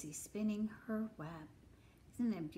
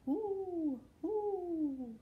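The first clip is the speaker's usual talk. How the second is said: high, long falling hoots; hooting owl; 8 semitones higher than usual. Acted. Reader plays the owl for children, high hooting. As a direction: hoot like a storybook owl. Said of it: "asked the owl".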